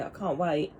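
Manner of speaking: ordinary talk, nothing marked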